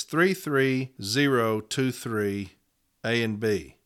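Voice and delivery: numbers read out slowly; slow dictation. Shown as a demonstration. Union rep slowly reading a case number to members. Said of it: "and here's those numbers".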